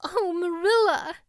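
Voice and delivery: gasped. A narrator's reading. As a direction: gasp out her name in dismay. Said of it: "gasped Anne".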